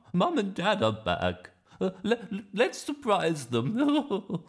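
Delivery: high, loud, stammering grandma voice; excited grandma. Acted. Grandma planning to surprise Mum and Dad, gleefully loud. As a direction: plot gleefully as a granny, ending in a chuckle. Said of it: "said Grandma".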